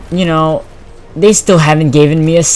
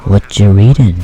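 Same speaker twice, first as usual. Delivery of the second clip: loud, lower imitated teacher voice; looming teacher; 4 semitones lower, 6 dB louder than usual. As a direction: put on a teacher prying at close range. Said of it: "she goes in my ear".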